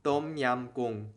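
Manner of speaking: "tom yam gung" slow and flat; slow demo. Shown as a demonstration. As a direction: sound out "tom yam gung" without tones. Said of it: "sounds like".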